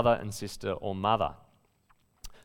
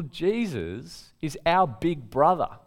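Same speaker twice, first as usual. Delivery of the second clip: ordinary talk, nothing marked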